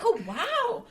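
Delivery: very high, drawn-out wow; mock wonder. Imitating people learning she's from Jamaica, mock wonder. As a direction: gush as if hugely impressed. Mimicking reaction to island origin.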